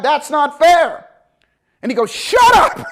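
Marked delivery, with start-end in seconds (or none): 0.0-1.1 slow, high, louder complaint; whiny protest. Retold or imitated. Protesting to instructor. 2.1-2.9 high, loud shouted Shut up; mock yell. Instructor yells, laughing.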